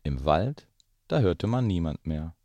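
put-on lively read-aloud voice for children; story narration. A narrator's reading. Parent reading The Gruffalo to the children, lively storytelling. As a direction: tell it like a bedtime story. Reads picture book aloud.

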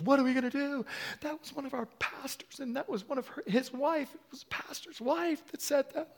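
high, anxious imitating of the staff; lightly voiced fretting pastor; worried staff.

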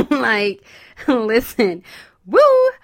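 loud laughing cheer; excited hype. Real, not acted. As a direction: hype the women up with a whoop.

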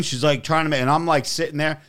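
ordinary talk, nothing marked

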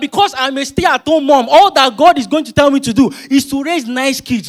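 high, loud preaching, near shouting; full-force preaching. Acted. Preacher to congregation, declaring in Jesus' name at full force. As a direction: rouse the congregation at the top of your voice.

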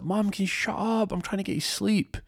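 high-pitched put-on son's complaint; acted kid wanting sleep, high voice; whining son. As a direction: pitch it way up, pestering Mum.

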